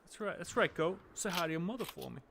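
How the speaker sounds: put-on Boston accent, comedy sketch style; playful mock celebrity sign-off; Mark Wahlberg impression. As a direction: parody Boston star making small talk. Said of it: "That's that S N L thing".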